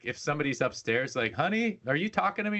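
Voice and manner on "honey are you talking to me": high-pitched questioning call; lilting, higher mock spouse voice; imitated spouse calling. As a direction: imitate a partner calling from upstairs.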